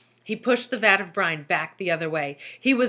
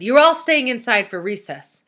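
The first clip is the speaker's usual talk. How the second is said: stern order; 4 semitones higher, 8 dB louder than usual. A narrator's reading. Angry teacher keeping class in.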